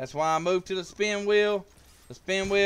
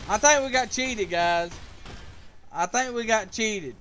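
aggrieved complaint; 5 semitones higher, 4 dB louder than usual. Real, not acted. Complaining right after losing.

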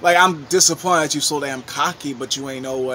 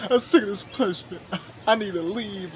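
mock fed-up whine; 5 semitones higher than usual. Acts out fed-up partner, half laughing.

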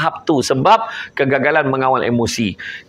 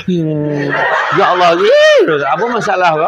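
high, loud mock exasperation; squealing "ya Allah" then a scolding question; exasperated reaction; 3 semitones higher, 4 dB louder than usual. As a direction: play the fed-up listener throwing up their hands. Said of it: "Dia punya feedback tu".

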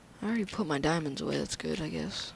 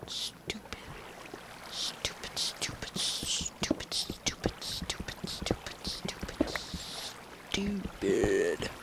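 whispering; 8 semitones lower, 4 dB quieter, slower than usual. Real, not acted. Frustrated muttering while lost.

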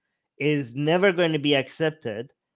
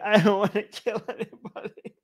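higher, whiny fake crying; mock tearful; 6 semitones higher than usual. Tearful Arjun refusing to fight his family, mock sobbing. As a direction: blubber it with a laugh creeping in. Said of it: "like, in tears".

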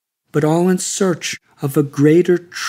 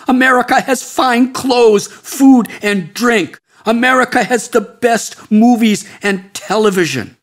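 climbs higher and louder with each "America has"; mounting envious shout; 7 semitones higher, 4 dB louder than usual. Acted. Sadhu listing America's riches to the seeker, rising envious shout. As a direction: pile up the riches, louder every item. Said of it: "he shouted at me".

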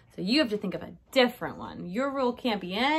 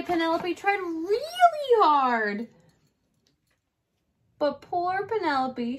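plain reading voice

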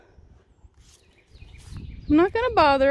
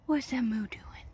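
whisper; 3 semitones lower, 5 dB quieter than usual. Real, not acted. Hushed near the baby animal.

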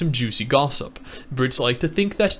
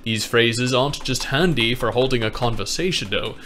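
measured, refined British reading; sophisticated British accent; 2 semitones lower than usual. Shown as a demonstration. Reading to a friend after promising better, sophisticated British accent. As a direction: read it like a cultured Brit. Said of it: "a sophisticated British accent now".

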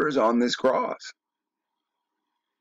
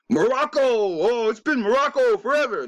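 high, hammed-up mock protest; mock indignant squeal, much higher; 11 semitones higher than usual. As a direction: squeal it like a scoffing know-it-all. Mocks a defender of names.

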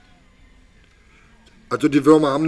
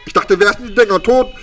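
usual speaking voice

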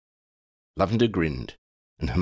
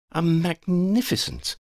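admiring; 13 semitones higher than usual. Acted. Hermione praising Ron, slowed.

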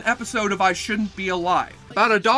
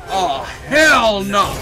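drawn-out loud "oh hell no"; slow shout; 6 dB louder, much slower than usual. Acted. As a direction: stretch it out and yell it. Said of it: "elicits a big".

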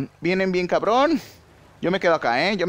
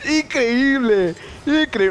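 scream, then stretch the word in disbelief; real gamer scream; agonized scream; 14 semitones higher, much slower than usual. Real, not acted. Screams at the play.

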